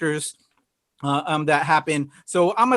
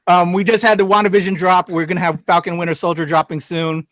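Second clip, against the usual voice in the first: ordinary talk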